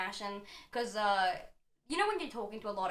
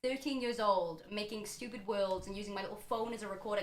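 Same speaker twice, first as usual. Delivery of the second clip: usual speaking voice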